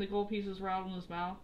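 usual speaking voice

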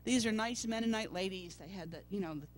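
normal talking voice

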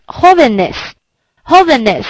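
"jóvenes" slowly, stressing the opening "jó"; stress on first syllable. Shown as a demonstration. Teacher models the accented plural, "jóvenes" stressed on "jó". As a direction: say "jóvenes" with the beat on "jó", letting "venes" fall away. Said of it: "它就会加上重音符号".

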